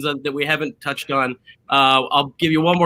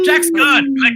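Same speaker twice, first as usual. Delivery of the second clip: high, louder mock exclamation; mimicked viewer outcry; 11 semitones higher, 6 dB louder, slower than usual. Host mimicking everyone still watching, high mock outcry. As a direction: cry out like a whole crowd of viewers.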